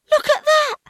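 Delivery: high, loud and thrilled; excited child. A narrator's reading. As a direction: point it out, amazed. Young owl excited.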